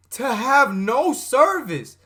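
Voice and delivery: drawn-out disbelief building to a high shout; exasperated outburst. Real, not acted. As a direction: start slow and incredulous, end yelling. Exasperated rant at story's narrator.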